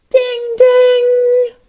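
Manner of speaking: high, ringing ding-ding like a bell. Acted. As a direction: chime it like a bell. Alarm bell rung again.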